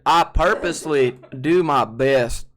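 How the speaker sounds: loud, thick Georgia Southern twang; strong Southern accent. Shown as a demonstration. Streamer showing viewers the accent he avoids, strong Southern accent. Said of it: "Can you do a strong Southern accent?".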